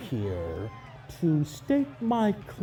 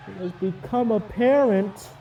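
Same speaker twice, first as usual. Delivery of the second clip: normal talking voice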